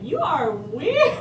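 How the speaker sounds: woman reacting to a stranger crying over her; teasing woman. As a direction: drag it out like a bemused friend. She was like.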